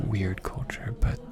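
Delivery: soft intimate whisper, speeding up at the end. Real, not acted. Whispering to her partner how much she wants a kiss. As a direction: confide it in a hush.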